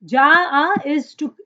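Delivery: usual speaking voice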